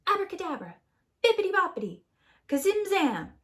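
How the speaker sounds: high sing-song spell words; magic incantation. Acted. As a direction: chant it like a wizard. Jack casting spells.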